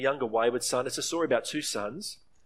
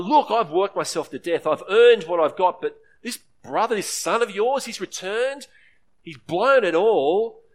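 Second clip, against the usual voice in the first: louder, rising indignation at the father; resentful complaint; 5 semitones higher, 8 dB louder than usual. Acted. Elder son complaining to his father, louder and resentful. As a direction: spit out the grievance, higher and hard. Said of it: "He's full of contempt".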